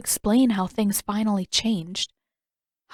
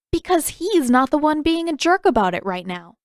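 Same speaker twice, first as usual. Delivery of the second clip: higher, louder comeback; sharp retort; 8 semitones higher, 6 dB louder than usual. A narrator's reading. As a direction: shut him down. Katara retorting to brother.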